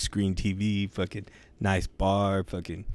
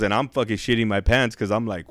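ordinary talk

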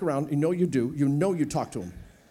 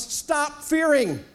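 drawn-out stern order; slow divine command; slow firm command; 6 semitones higher, much slower than usual. Acted. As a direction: say it slowly as God's command. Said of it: "first thing out of God's mouth was".

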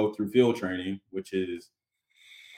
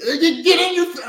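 much higher, louder mock yell; imitating a yelling instructor; 6 dB louder than usual. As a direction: parody a throat-straining yell. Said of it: "I grew up with yelling".

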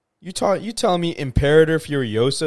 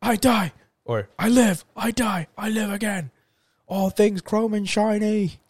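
Mad Max fan quote; 10 semitones higher than usual. Pretend. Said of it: "all right, I'm Mad Max".

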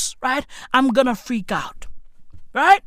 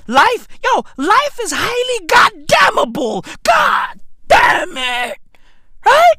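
much higher, loud shouted rant; 9 semitones higher, 4 dB louder than usual. Acted. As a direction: rant it up high.

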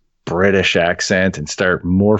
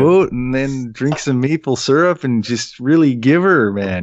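hammed-up, higher Canadian accent; 5 semitones higher than usual. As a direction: imitate a cartoonish Canadian. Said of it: "someone doing their Canadian accent".

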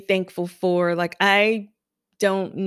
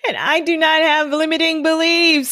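fired up; 8 semitones higher than usual. Real, not acted. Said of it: "affirmations, firing up".

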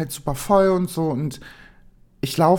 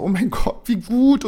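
higher and louder, thrilled; excited relief; 6 semitones higher, 4 dB louder than usual. Retold or imitated. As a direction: burst out with glad relief. Relieved at finding room. (begeistert und erleichtert, höher und lauter)

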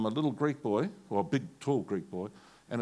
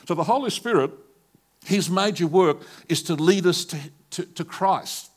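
loud, ringing pulpit voice; fervent preacher lifting the crowd; full-force preaching; 5 semitones higher, 9 dB louder than usual. Acted. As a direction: preach it out to the whole hall.

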